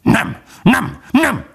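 very high, yapping dog cry; vicious barking. A narrator's reading. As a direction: bark the refusals out sharply, very high. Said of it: "kiáltott rá a kutya gonosz ugatással". (gonosz ugatással kiáltva)

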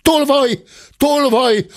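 high-pitched cry of "thief"; cried alarm. A narrator's reading. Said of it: "hallatszott ebben a percben egy kiáltás".

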